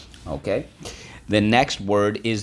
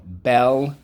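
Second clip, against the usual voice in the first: loud, high "Bell"; loud model syllable; 5 semitones higher, 6 dB louder than usual. Shown as a demonstration. Teacher leading the class chant, loud. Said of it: "Let's go".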